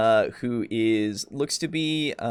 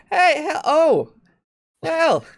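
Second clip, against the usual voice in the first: high, loud cheery greeting that stumbles; chipper flustered hello; 6 dB louder, slower than usual. Acted. Shopkeeper greeting the second customer, high and cheery. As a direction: shopkeeper fumbling a too-bright hello. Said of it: "she's just".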